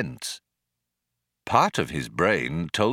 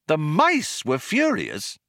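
slow, higher-pitched disbelieving repeat; incredulous echo; 6 semitones higher than usual. A narrator's reading. Visitor repeating the old man's claim, incredulous. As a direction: echo it back in disbelief, MICE lifted high.

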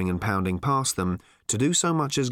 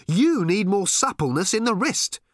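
protesting; 8 semitones higher, 3 dB louder than usual. A narrator's reading. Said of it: "protested Arthur".

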